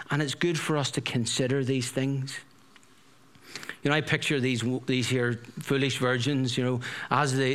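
ordinary talk

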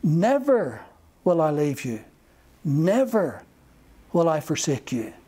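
NEVER hit hard each time; emphatic reading. Shown as a demonstration. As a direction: punch each Never, then drop low for the rest. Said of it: "it's very emphatic".

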